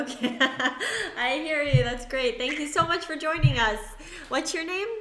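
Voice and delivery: high, loud, laughing welcome; delighted, bubbling over with joy; excited laughing. Real, not acted. Caller finally gets through.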